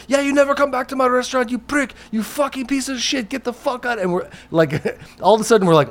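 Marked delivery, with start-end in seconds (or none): 0.1-4.0 high, fast mock tirade without real volume; high-pitched, held-back mock scream; Sammy screaming. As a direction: imitate an owner chasing someone out. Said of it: "he starts screaming crazy shit".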